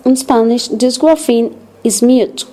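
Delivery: ordinary delivery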